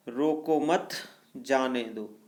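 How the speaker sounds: "रोको मत" rising, break, then "जाने दो" falling; pause after मत. Shown as a demonstration. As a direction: lift "मत", pause, release "जाने दो". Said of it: "रोको मत के बाद अल्पविराम लगाने". ("रोको मत" के बाद ठहराव, फिर "जाने दो")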